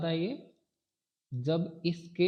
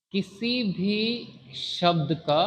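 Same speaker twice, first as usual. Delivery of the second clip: slow dictation; 3 semitones higher, 3 dB louder, much slower than usual. Shown as a demonstration. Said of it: "किसी भी (repeated in chunks)".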